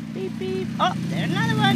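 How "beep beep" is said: beep beep. Shown as a demonstration. Car noises for baby.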